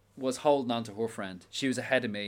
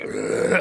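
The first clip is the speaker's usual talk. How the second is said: short, louder unvoiced zombie grunt; zombie groan; 4 semitones higher, 6 dB louder than usual. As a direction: groan like a creature skulking towards you.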